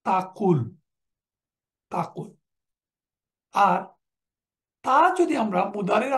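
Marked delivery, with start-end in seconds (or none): 0.1-2.3 তাকুল said clearly, ending on a short closed l; তাকুল said twice, clipped at the end; pronunciation demo. Shown as a demonstration. Teacher showing the jussive form, তাকুল said clearly. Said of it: "করলে হবে".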